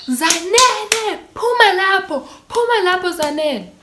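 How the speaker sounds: high-pitched mock call of a worried mother; mom calling out. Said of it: "She's like".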